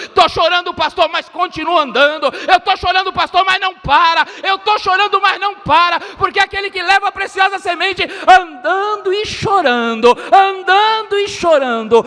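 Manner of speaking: high, driving pulpit cry; impassioned, high-pitched exhortation; full-force preaching. Real, not acted. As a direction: preach it at the top of your range, pressing on.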